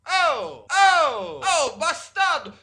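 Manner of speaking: high, loud enraged yelling; furious shout. Acted. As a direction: explode in jealous rage. Husband shouts seeing wife with teacher.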